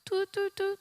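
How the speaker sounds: high-pitched imitated radar "toot"; radar alert beeps. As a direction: go off like a mother's warning radar. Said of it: "on your mother's radar, go".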